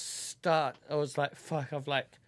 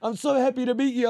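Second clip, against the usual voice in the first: high, gushing delight at meeting; 8 semitones higher, 7 dB louder, faster than usual. Retold or imitated. Guy gushing on first meeting, high and excited. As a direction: gush like a smitten fan.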